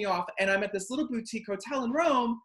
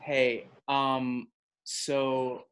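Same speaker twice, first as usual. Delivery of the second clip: lower, dead-straight serious voice; straightest voice; 5 semitones lower, much slower than usual. Retold or imitated. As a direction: play it completely straight and deep. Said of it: "in the straightest voice I can do".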